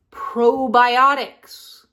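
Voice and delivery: "probiotics" stretched out slowly and loud; slow, loud emphasis on "probiotics"; emphatic. Real, not acted. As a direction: hit "probiotics" hard, drawing out each syllable. Singles out key word.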